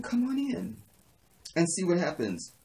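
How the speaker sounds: high, catty invitation; mock-sweet dare. Woman dares rival to enter her open door, high mock-sweet dare. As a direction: pitch it up as a teasing challenge.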